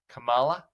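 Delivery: "Kamala" with the stress moved off the first syllable; mispronounced name. Retold or imitated. Commentator imitating Trump's mangling of her name, mispronounced "Kamala" with shifted stress. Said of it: "he also is intentionally mispronouncing her name".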